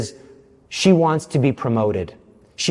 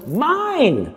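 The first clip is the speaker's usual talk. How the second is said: indignant cry; 11 semitones higher than usual. Retold or imitated. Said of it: "Whose presentation was stolen".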